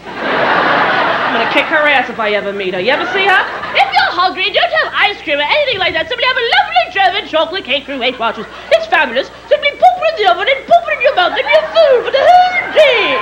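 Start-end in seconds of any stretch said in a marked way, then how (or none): 3.7-13.2 lightly put-on, higher-pitched commercial voice; mock diet-ad pitch. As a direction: climb higher and higher like a gushing ad spokesperson. Mocking a sugary diet commercial pitch.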